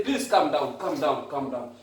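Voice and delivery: soothing, fading calm. Retold or imitated. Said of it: "you say".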